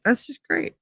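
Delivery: dry sarcasm about herself; sarcastic. Real, not acted. Sarcastic reaction to her mood.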